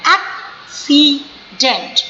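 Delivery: Accident as ak... si... dent, well spaced; slow, slow. Shown as a demonstration. Teacher telling pupils hand on chin, accident slowly split. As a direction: slowly give accident three separate beats. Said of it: "slow slow करके बोलिए".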